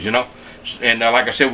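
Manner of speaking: usual speaking voice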